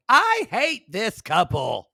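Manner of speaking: high, loud, slow disgust; mock-outraged reality TV recap; disgusted reading. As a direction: drag out your contempt for them. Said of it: "And I wrote".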